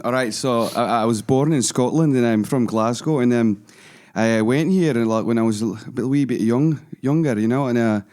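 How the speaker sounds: chatty put-on Scottish accent. Shown as a demonstration. Glasgow-born man telling how he settled in Perth, Scottish accent. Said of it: "Scottish and Irish and a Russian accent".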